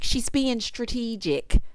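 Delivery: slow, drawn-out catchphrase imitation; Nene. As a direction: lightly mimic a reality star, stretching it out. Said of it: "shout out to Nene".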